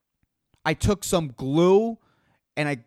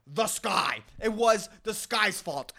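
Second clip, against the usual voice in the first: normal talking voice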